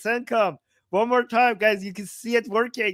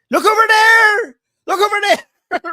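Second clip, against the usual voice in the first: mock frantic distraction; 13 semitones higher, 11 dB louder than usual. Regime pointing people at Lebanon, hammed-up high loud cry. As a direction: wave the crowd's eyes away in a hammed-up panic.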